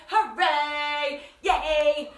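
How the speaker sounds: loud sung-out hooray; cheering. Real, not acted. Cheers ending the song.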